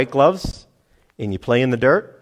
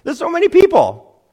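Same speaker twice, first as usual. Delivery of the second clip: very high, loud exasperated disbelief; hammed-up, squeaky-high bafflement; incredulous disciples; 7 dB louder than usual. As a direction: protest as if it's a dopey question. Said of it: "thought it was a dopey question".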